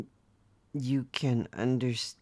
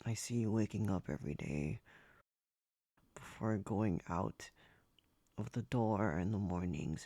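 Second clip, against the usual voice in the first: mildly sad; 2 semitones lower, 7 dB quieter than usual. Acted. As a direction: speak quietly with sorrow for them. Describing master's daily sadness.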